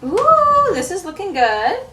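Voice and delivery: high, loud drawn-out ooh; delighted ooh. Real, not acted. Sewer admiring work that looks good, high excited ooh. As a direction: coo loudly with pleased excitement. Pleased at the result.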